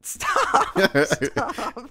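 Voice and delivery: high, loud "stop" through laughter; helplessly amused plea; laughing protest. Real, not acted. Telling a friend to stop the jokes, laughing protest.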